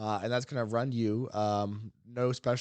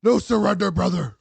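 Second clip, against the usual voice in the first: big booming mock war cry, much higher and louder; battle cry; 9 semitones higher, 12 dB louder than usual. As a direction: yell it like a hammed-up soldier charging in. Joking battle cry after crit talk.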